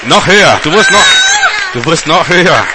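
excited shouted cheering; ecstatic urging. Real, not acted. Urging the patient to scream.

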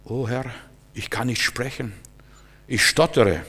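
soft, low, timid Moses; hushed, self-doubting reply to God; meek excuse. Acted. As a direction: plead inability in a small voice. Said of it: "was sagt unser Bruder Moses?".

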